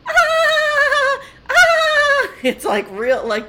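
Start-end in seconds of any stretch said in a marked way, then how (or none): 0.1-2.3 piercing high squeal; high shriek. Retold or imitated. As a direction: squeal like a dolphin. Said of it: "she's like".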